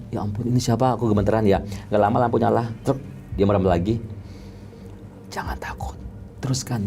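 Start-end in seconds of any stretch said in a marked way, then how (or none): 5.3-6.8 eerie, hushed ghostly whisper; whispered imitation. Spirit tells the frightened makeup artist to continue, whispered. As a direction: whisper it like a spirit urging someone on.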